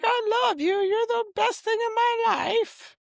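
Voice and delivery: high mock-loving voice as he quotes her card; mocking ex-wife voice. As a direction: mimic her sugary fake devotion. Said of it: "So she's like".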